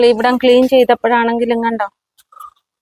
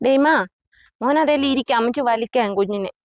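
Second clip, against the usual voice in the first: higher, softer coaxing coo; 3 semitones higher, 5 dB quieter than usual. Real, not acted. Woman inviting her pet Daimon to sit while she pulls, soft high baby talk. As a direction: sweetly invite the pet to hop on.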